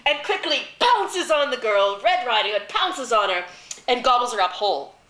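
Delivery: high, loud storytelling rush; excited climax. A narrator's reading. Storyteller tells of the wolf pouncing, excited.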